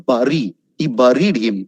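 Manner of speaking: bury, buried as "barry", "barried"; wrong pronunciation. Shown as a demonstration. Teacher giving the don't-say example, mispronounced. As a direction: open the first vowel of bury to "ah".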